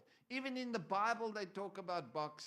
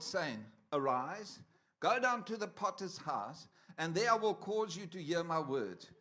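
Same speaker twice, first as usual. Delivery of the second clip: loud, declaiming scripture reading; 4 dB louder than usual. Acted. Preacher reading Jeremiah aloud to the church. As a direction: proclaim the verses to the congregation.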